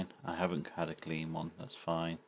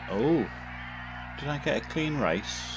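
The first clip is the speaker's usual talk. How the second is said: excited; 6 semitones higher, 6 dB louder than usual. Real, not acted. Finishing the stage, checking result.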